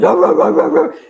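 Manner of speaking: loud breathy barking noises; imitated dog bark. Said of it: "my dog was gonna be barking".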